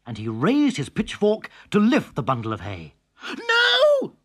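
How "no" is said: high loud squeal. A narrator's reading. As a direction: squeal in alarm. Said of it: "squealed Holly".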